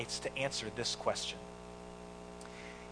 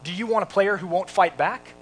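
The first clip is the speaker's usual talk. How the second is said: loud, higher, indignant challenge; Jackie Robinson bowing up; 5 semitones higher, 8 dB louder than usual. Retold or imitated. Player challenging the team owner's screaming, retold bristling retort. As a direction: push back hard, ending on a rising question. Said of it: "kind of bows up and he says".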